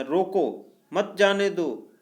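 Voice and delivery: "रोको" set apart, then "मत जाने दो" as one phrase; pause after रोको. Shown as a demonstration. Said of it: "रोको के बाद अल्पविराम लगाने से". ("रोको" के बाद विराम, फिर "मत जाने दो")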